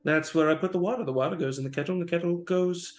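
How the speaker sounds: high, fast, agitated babble; frantic mad dragonborn. Acted. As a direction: snap about the kettle like a fussy madman. Drake frantic about his kettle.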